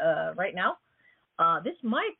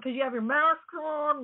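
usual speaking voice

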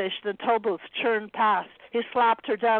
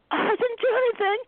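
high, quick nervous denial; hasty denial; 13 semitones higher than usual. A narrator's reading. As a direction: deny it in a rush. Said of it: "Anna replied hastily".

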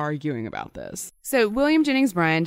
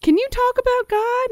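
much higher, hammed-up squeaky voice; mock squeaky question. Teasing the lawyers' plea, silly high falsetto. Mock voicing the defense team.

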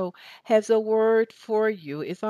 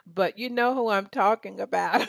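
ordinary talk

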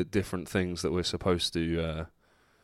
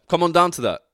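excited; 8 semitones higher, 7 dB louder, faster than usual. Real, not acted. Excited plug.

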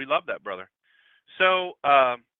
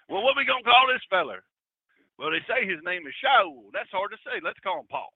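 louder, higher mock country fellow; mock folksy voice; 5 semitones higher, 6 dB louder than usual. Preacher joking how they named Paul, mock folksy voice. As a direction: play a puzzled old-timer picking a name.

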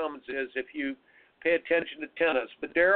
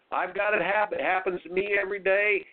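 usual speaking voice

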